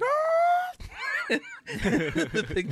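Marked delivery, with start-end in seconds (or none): 0.0-1.3 very high imitated creature-scaring howl; mimicked high-pitched screech; weird scare-off screech. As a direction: let out a high, loud, weird wail. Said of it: "Obi-Wan just makes the weirdest noise".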